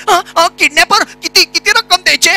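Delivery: high, loud, stammering plea for a discount; panicky victim haggling. Shown as a demonstration. Radio ad victim pleading with the kidnapper, high and loud. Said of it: "next, काळा पहाड बोलतोय".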